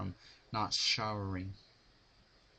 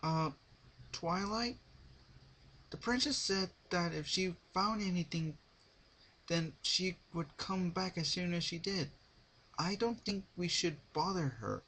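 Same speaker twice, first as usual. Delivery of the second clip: lightly voiced young dragon, higher and unsure; hesitant Spike; 10 semitones higher than usual. A narrator's reading. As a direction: tread carefully around a cranky friend.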